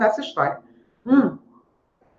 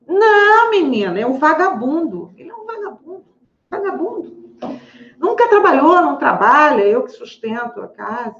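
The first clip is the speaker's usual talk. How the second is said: put-on patient scoffs at her lazy boyfriend; indignant patient; 5 semitones higher than usual. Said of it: "ela, retold".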